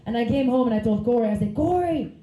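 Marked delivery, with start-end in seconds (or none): none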